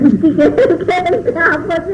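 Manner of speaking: real laughter breaking up the words; words dissolving into a laugh. Real, not acted. Laughs at her own joke.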